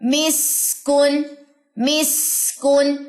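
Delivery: मिस्कुन very slow, with a breathy स; मिस्कुन recited slowly as a teacher; slow recitation demo. Shown as a demonstration. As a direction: stretch मिस्कुन and keep the air flowing. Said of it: "अब इसकी example देखिए".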